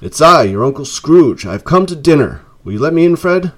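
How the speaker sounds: high, loud, climbing to a hopeful plea; eager announcement. A narrator's reading. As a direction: announce yourself eagerly, rising into the question. Scrooge announces himself to his nephew.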